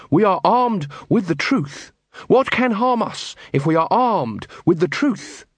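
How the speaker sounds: slow, high-pitched declaration; earnest Carrot. Acted. Carrot rallying the unarmed watch, earnest. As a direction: proclaim it with measured conviction.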